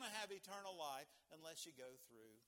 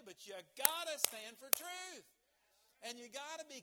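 emphatic preaching; 6 semitones higher, 8 dB louder than usual. Acted. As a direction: plant your feet on truth. Sermon climax.

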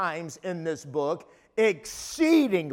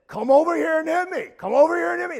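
high, quick, raised-voice dare; imitating taunting boy; 7 semitones higher, much faster than usual. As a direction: act out a boy goading someone to fight. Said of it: "the one boy said".

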